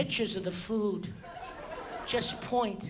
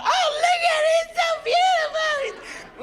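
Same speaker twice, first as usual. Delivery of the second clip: put-on very high, squealing old lady voice; old lady gushing; 4 dB louder than usual. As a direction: gush like a delighted little old lady. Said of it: "little old ladies are up there freaking out".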